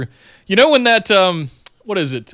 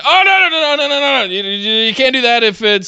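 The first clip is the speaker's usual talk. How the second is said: Shane McMahon; 7 semitones higher than usual. Retold or imitated. Mimicking the boss making up rules mid-fight, mock panic. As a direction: frantically wave off the fighters, hammed-up.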